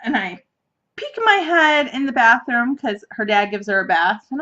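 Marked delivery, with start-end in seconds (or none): none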